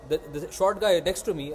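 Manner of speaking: ordinary delivery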